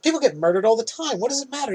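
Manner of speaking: high, quick imitation of brushing off; hammed-up nonchalant therapist; mock dismissive. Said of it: "And she goes".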